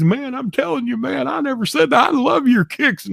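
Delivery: very high, pleading denial; hammed-up scared squeal. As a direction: whimper out the excuse. Tim's frightened denial, high voice.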